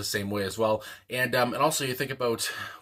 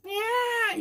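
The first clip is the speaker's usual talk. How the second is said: screeching falsetto yeah, way up high; high falsetto yell. Retold or imitated. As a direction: squeal it in a strained falsetto like the backing vocal. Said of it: "I, that was terrible".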